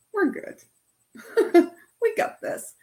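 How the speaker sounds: high, bright, laughing cheer to herself; pep talk. Retold or imitated. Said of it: "a little pep talk".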